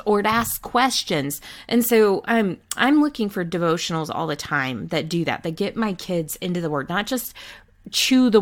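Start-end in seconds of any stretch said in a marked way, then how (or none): none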